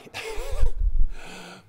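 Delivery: loud burst of laughter; real, hearty laugh mid-sermon. Real, not acted. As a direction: break into a loud, high laugh. Preacher laughs at own point.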